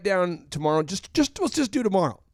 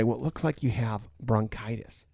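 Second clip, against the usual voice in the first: lower, softer, matter-of-fact doctor; a lightly voiced doctor reading out the verdict; doctor tells the patient the news, lower and softer; doctor's diagnosis; 7 semitones lower, 7 dB quieter than usual. Doctor giving sick man diagnosis.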